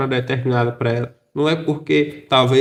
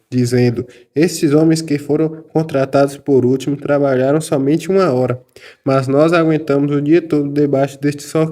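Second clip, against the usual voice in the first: a lightly raised, measured Bible reading voice; scripture reading; 2 semitones lower, 3 dB louder than usual. Acted. Reading verse eleven to the study group, a touch louder. Reads the Bible passage aloud.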